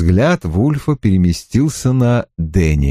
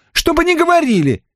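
возмущённо; faster than usual. A narrator's reading. Мариэлла возмущённо отрицает.

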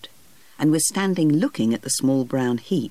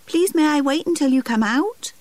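put-on high pleading small boy; a little boy asking nicely, voice pitched well up; 13 semitones higher, 4 dB louder than usual. Geoffrey pleading with the gardener, high small boy voice. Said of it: "asked Geoffrey".

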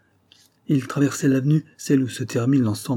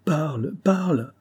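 higher, slow, shaken voice; trembling plea; 6 semitones higher, slower than usual. A narrator's reading. Frightened widow begs daughter to speak, trembling and slow. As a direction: tremble while begging her to speak. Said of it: "en commençant à trembler".

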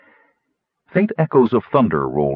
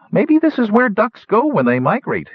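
gnome theorizing; 13 semitones higher than usual. A narrator's reading. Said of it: "he posited".

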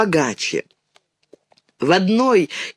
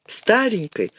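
usual speaking voice

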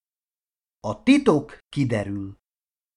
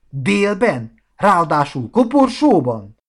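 high, loud disbelieving questions; incredulous; 6 semitones higher, 4 dB louder than usual. Acted. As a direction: pile up the questions in mounting disbelief. Marci's baffled follow-up questions. (hitetlenkedő, emelt hangú kérdezés)

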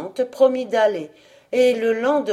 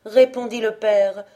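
plain reading voice

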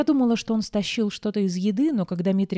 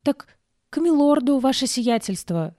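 put-on flustered boy; 7 semitones higher, 3 dB louder than usual. A narrator's reading. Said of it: "растерялся Митрик".

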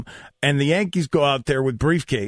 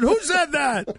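surprised; 11 semitones higher than usual. Retold or imitated. Players reacting to crude heckle.

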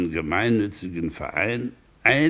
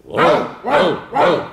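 triple cheer; 7 semitones higher, 6 dB louder than usual. Acted. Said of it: "ein dreifaches".

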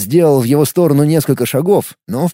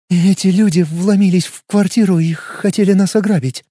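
shaky voice; 4 semitones higher than usual. A narrator's reading. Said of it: "дрожащим голосом произнесла Вики".

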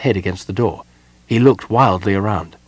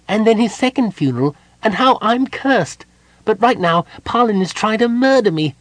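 high, loud piping character voice; chattering kender; 13 semitones higher, 4 dB louder than usual. A narrator's reading. Tass excuses himself to Gold Moon before fleeing, chattering. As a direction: rattle on in a high little voice, pushing to get away.

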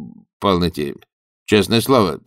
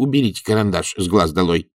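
ordinary delivery

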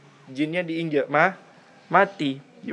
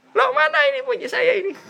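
much higher, startled and laughing; a panicked comic cry of alarm; shocked man; faster than usual. Acted. The man panics when he finds his private parts gone.

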